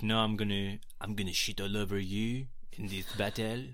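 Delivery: mock French accent; piss-take French accent on a boast. Co-host voicing the French prince before battle, French accent. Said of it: "I'm amazing at a French accent".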